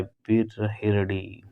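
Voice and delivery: बिरहिड़ी said very slowly, every syllable stretched; slow spelled-out word. Shown as a demonstration. Teacher to students, spelling out बिरहिड़ी very slowly. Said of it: "बिरहिड़ी होता है".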